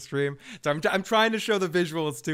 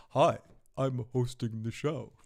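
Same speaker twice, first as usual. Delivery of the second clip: Garth impression; 3 semitones lower than usual. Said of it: "[name]'s like Garth".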